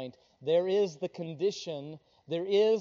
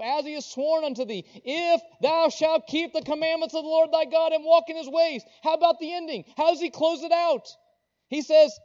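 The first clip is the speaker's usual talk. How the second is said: raised, driving preacher's delivery; full-force preaching; 6 semitones higher, 10 dB louder than usual. Real, not acted. Preacher urging congregation to obey the commandments, full-force preaching. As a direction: press each point home loudly, pitched up.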